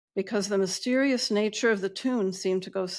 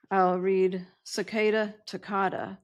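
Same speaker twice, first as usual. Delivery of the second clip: ordinary talk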